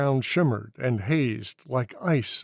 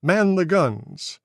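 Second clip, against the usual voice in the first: loud, high, slow command; shouted order; 6 semitones higher, 4 dB louder than usual. A narrator's reading. Officer shouting orders to man the guns. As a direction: bark the order to the crew. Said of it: "the officer shouted".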